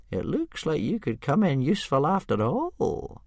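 put-on slow, high old man aside; Old Wrinkly musing aloud; murmured to himself. A narrator's reading. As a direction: muse privately. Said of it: "murmured to himself".

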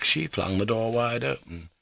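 usual speaking voice

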